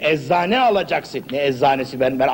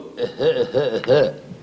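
cough-laugh; 5 semitones lower than usual. Acted. Patient coughing on command as laughter.

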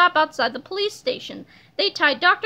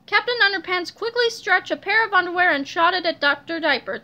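plain reading voice

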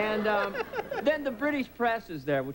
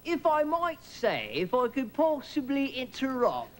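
slow, high, over-polite British hemming; apologetic British driver; 6 semitones higher, slower than usual. Retold or imitated. Driver tries to interrupt his passengers, slow high apologizing. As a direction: hedge politely, dragging out each phrase. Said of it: "he would apologize three times before he spoke".

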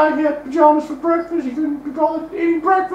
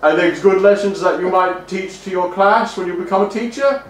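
deep, pompous voice lecturing on lessons; stuffy moralizing voice; 12 semitones lower than usual. Acted. Host questioning the guest, in a deep, pompous put-on voice. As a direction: talk down like a prim old schoolmaster.

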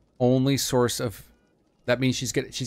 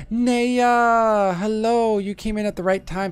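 excited greeting; 11 semitones higher, 6 dB louder than usual. Real, not acted.

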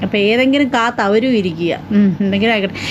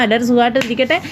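ordinary delivery

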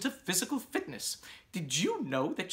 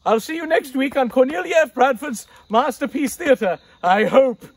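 flustered sign-off; 4 semitones higher, 12 dB louder than usual. Acted. Signing off while being dragged.

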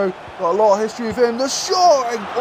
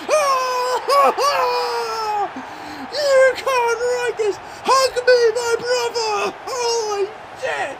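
much higher, tearful joyous shouting; ecstatic crying out; much slower than usual. Real, not acted. Fan celebrating the winning goal, crying joyful shouts. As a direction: weep with joy at the goal. Last-minute cup winner celebration.

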